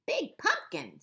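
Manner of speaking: high little bat voice; tiny bat voice. Acted. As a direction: squeak it like a small bat. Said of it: "said the bat".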